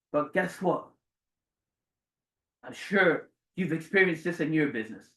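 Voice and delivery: normal talking voice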